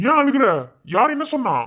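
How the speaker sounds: high, loud worried questioning; alarmed concern. Acted. Uncle asks crying niece who said what, alarmed. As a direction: demand anxiously who upset them.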